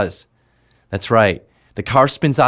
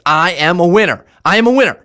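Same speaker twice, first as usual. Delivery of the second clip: higher, louder, forceful affirmation; energized coach shouting an affirmation; real thrust and energy; 5 semitones higher, 4 dB louder, faster than usual. Shown as a demonstration. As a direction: punch it with total conviction. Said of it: "Now say it like this".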